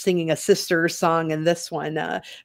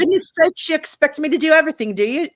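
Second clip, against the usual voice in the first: high, teasing posh British voice; British accent; 10 semitones higher, 5 dB louder, faster than usual. Acted. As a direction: put on a British accent and tease. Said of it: "your British accent".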